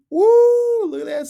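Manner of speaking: high loud whoop; excited cheer. Acted. Teacher whooping for the kids, loud and high. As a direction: whoop like you're in the fight. Cheering snowball fight picture.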